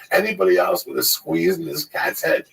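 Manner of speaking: normal talking voice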